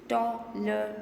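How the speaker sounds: teacher sounding out "Tolerance" piece by piece; split into syllables. Shown as a demonstration. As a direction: say "Tolerance" in separate beats. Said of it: "How many syllables?".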